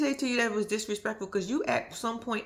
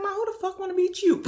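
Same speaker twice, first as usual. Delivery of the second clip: higher, louder incredulous son; mimicking son; 12 semitones higher, 4 dB louder than usual. Vlogger imitating Louis brushing off his mom, higher incredulous voice. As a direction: toss it off like a fed-up son. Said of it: "He was like".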